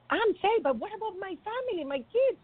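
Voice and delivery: high, distressed retold plea; tearful plea. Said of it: "And he was crying".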